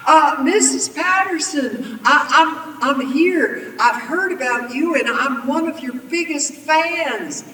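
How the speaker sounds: high, loud, stammering flattery; gushing fake fan. A narrator's reading. Villain buttering up the old lady, gushing. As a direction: pour on eager, phony admiration.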